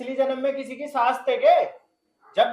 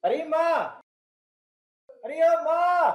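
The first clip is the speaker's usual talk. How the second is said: calling out; 4 semitones higher, slower than usual. Acted. Son calls for his mother about morning tea, calling out. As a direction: call out for mother, pitch raised on a drawn word. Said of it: "अरे क्यों मामा चिल्ला रहा है".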